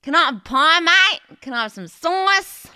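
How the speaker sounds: high, loud Australian twang; nasal Aussie, all nose; exaggerated Aussie twang. Shown as a demonstration. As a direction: belt it like a hammed-up Australian. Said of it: "Give me all of your nose doing all of the work".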